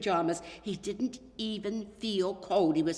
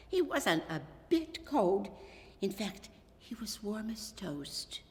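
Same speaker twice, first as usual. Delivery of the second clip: ordinary delivery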